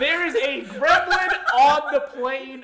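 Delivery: slow, loud and high, every word stretched; emphatic shout. Acted. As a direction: bellow it slowly over the laughter. Repeats it loudly over laughter.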